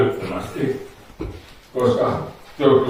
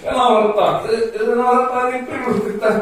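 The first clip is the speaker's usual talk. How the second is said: much higher, laughing, building hype; giddy hype; 7 semitones higher than usual. Acted. Hypnotist making volunteers laugh harder and harder, giddy high-pitched hype. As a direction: crank the giggles ever higher.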